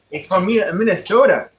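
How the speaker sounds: put-on Minnesota accent. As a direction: playfully imitate a Minnesotan, stretching "Minnesota". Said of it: "What if I talk like that, B?".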